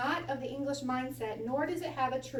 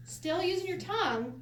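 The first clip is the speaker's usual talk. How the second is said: ordinary delivery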